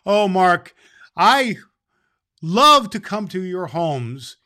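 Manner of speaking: loud, slow, beaming reply; delighted. Real, not acted. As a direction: burst out with joy at the letter. Santa delighted by letter.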